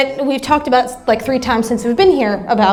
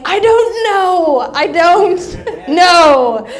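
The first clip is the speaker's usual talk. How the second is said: laughing; 4 semitones higher, 3 dB louder, much slower than usual. Retold or imitated. Laughing helplessly at daughter's questions.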